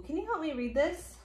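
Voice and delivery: plain reading voice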